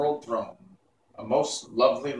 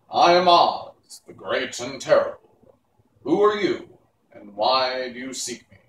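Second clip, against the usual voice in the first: commanding lady Oz; 8 dB louder than usual. A narrator's reading. The lady Oz greets the Scarecrow, loud and lofty. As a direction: announce yourself as a mighty queen.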